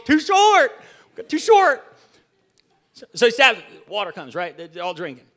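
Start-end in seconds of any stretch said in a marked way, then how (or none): 0.0-1.8 very high, loud squawk; hammed-up high yell, last word held; mock protest. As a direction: cry out in exaggerated complaint. Mock protest about his summary.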